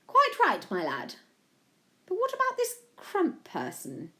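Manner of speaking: high-pitched royal lady, falling away on the ends; swooping, regal question; sincere Queen. A narrator's reading. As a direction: play a queen asking in earnest. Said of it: "The Queen asked sincerely".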